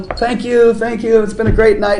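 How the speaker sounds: high, cheery performer's sign-off; smiling stage thanks. Retold or imitated. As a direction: put on a big stage smile, voice lifted. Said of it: "and I'd smile".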